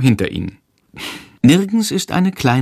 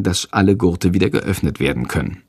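ordinary delivery, nothing marked

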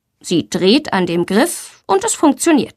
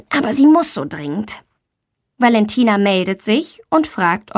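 usual speaking voice

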